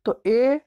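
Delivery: ए said as a stressed ay, pitched high; strong form a. Shown as a demonstration. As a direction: lift and stress ए as ay. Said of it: "विशेष जोर देना हो".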